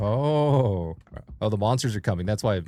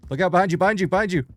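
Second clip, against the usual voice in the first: urgent warning shout, higher; alarm shout; 7 semitones higher, much faster than usual. Real, not acted. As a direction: yell the warning fast. Warning teammate of monster.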